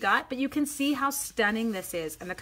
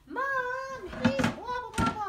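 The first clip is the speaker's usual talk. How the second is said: high mock whine of a tattling child; whiny kid; 14 semitones higher than usual. Mother imitating her kids tattling to her, high mock whine. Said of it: "I'm like".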